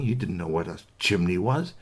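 usual speaking voice

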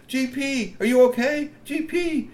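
high anxious calling; worried troll. Acted. Strongbones rushing to G P, high worried call. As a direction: call out to him with worry.

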